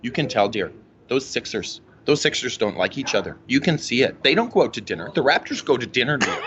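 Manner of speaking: low, knowing mom voice; imitating mom. Son voicing his mom on the Sixers. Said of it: "she goes".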